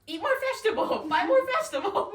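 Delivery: high, loud mock cashier nagging; bossy cashier. Mimicking the cafeteria cashier, high loud nagging. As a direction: nag like a pushy lunch lady. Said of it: "she was like".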